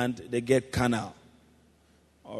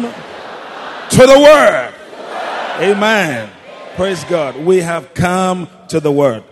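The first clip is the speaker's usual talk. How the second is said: loud, fervent preaching, higher and louder; preaching at full force; 4 semitones higher, 14 dB louder than usual. Acted.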